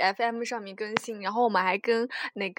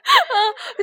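笑出声; 14 semitones higher, 6 dB louder than usual. Real, not acted. Laughs at nose voice.

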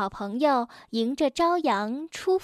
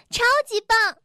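loud joyful cheer; excited; 8 semitones higher, 4 dB louder than usual. A narrator's reading. Bengbeng cheers over his new tooth, excited. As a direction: cheer while dancing about. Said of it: "蹦蹦兴奋地手舞足蹈".

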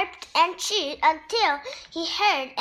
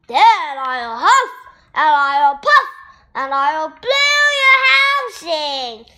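growling wolf; 7 dB louder than usual. A narrator's reading. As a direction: menace them word by word. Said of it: "growled the wolf".

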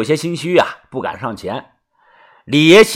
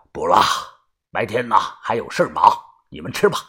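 low, tired-sounding elder voice; subdued old man; 7 semitones lower, 5 dB quieter than usual. Acted. Grandfather turning down breakfast, subdued. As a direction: decline quietly in a lowered old voice.

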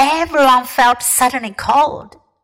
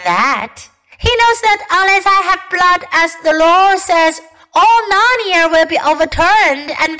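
higher, menacing witch voice; cruel witch; 6 semitones higher than usual. A narrator's reading. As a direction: threaten with icy triumph. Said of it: "a savage smile that was almost a snarl".

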